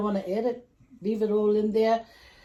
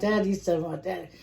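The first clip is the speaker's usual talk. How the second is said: ordinary talk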